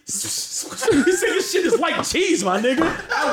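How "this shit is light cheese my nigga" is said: high, drawn-out mock yell; mock exasperation. Retelling a friend's verdict on a haircut, mock exasperated. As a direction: hammer the punchline in imitated outrage. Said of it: "Doc got so mad".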